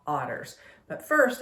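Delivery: ordinary talk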